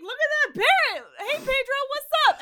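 very high, loud, hammed-up greeting; mock excitement. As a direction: squeal hello at a parrot in disbelief. Mocking delighted parrot greeting.

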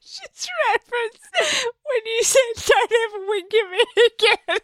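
very high, squeaky with real laughter; helpless laughter. Real, not acted. Co-host recalls being told not to wink, laughing helplessly. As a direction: barely get it out through weeping laughter.

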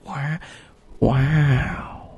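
lightly done film-star impression; a drawn-out wow in a mild impression; Owen Wilson. Co-host asking about burnt toast, Owen Wilson impression. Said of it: "there's already an Owen Wilson impressionist".

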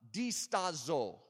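slow Italian-style "Distazo"; Italian-sounding accent. Shown as a demonstration. Preacher reads a Greek word to congregation, Italian-sounding accent. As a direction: draw "Distazo" out slowly as if Italian. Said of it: "Now that sounds like Italian".

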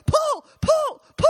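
high, loud shouted "pull"; shouting. Retold or imitated. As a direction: bark out each pull like an impatient boss. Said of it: "Vittorio's going".

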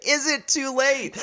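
high, slow street urchin voice; little street urchin, laughing through it; plucky hopeful orphan. Retold or imitated. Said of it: "I miss your little street urchin voice".

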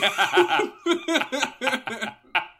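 long high cackling laugh; loud rattling belly laugh; burst of laughter. Real, not acted. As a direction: crack up loudly and keep going. Cracks up at own joke.

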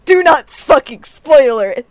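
loud panicked yell; shouting. Retold or imitated. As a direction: yell it at the chat. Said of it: "We were like".

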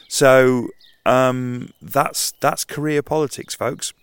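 sarcastic. Real, not acted. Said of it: "sarcastic punchline to listeners".

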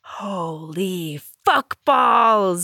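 slow, drawn-out amazement; stunned. Real, not acted. Reader reacting to the big twist, stunned. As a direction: stretch each word out in awe.

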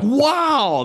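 high, loud mock-awed wow; retold amazement. Fans at conventions hearing the story, imitated high amazement. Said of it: "and they're like".

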